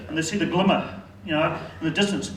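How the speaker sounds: ordinary talk, nothing marked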